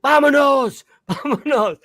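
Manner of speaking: loud, high barked-out words; dog urgently calling to leave; talking dog. Retold or imitated. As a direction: bark the word out like a dog. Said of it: "I hear my dog talking words".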